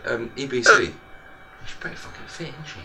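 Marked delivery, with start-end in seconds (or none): 1.6-2.8 much softer, whispered leer; whispered aside. Real, not acted. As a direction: whisper it cheekily. Leering aside.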